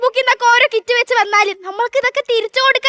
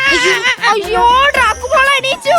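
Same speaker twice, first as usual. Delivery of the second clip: mock wail. Mother wails as baby Dracula wakes.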